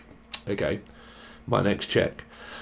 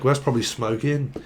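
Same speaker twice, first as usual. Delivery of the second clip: ordinary delivery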